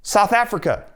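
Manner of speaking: high, excited exclaiming; excited insistence. Real, not acted. Picking the South Africa video to play, excited.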